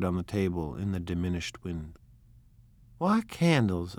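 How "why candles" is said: objecting. Acted. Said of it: "objected Daisy".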